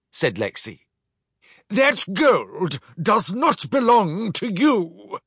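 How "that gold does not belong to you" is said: higher, slow mock protest; a touch of offended officer. Commander protests the theft, mildly offended. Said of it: "protested the commander".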